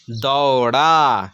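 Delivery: दौड़ा drawn out slowly, dau-raa; teacher blending दौड़ा slowly for learners; slow blended reading. Shown as a demonstration. Teacher to pupils, slowly reading the blended word. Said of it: "अड़े अलिफ ज़बर रा".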